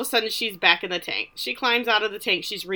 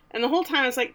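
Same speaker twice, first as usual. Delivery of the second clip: normal talking voice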